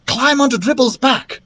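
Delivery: high-pitched princess command; insistent character voice; ordering. A narrator's reading. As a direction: give an urgent order. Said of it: "Princess Pulverizer ordered".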